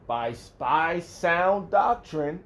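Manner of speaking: DOCTRINE loud, high and drawn out; emphatic reading. Real, not acted. Preacher stressing a scripture word for listeners, slow, loud emphasis on "doctrine". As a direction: draw out "sound doctrine" slowly with loud emphasis. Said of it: "How you emphasize the word".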